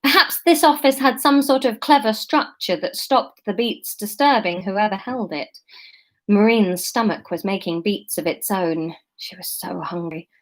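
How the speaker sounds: usual speaking voice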